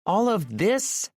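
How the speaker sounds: "this" stressed, high and slow; emphasis on this. A narrator's reading. Aurora gestures at Maxim, stressing "this" teasingly. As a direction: lean on "this" playfully. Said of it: "with an emphasis on the word this".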